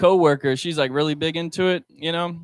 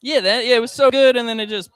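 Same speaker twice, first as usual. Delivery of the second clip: excited agreement; 7 semitones higher, 6 dB louder than usual. Real, not acted. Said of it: "agreeing with crowd".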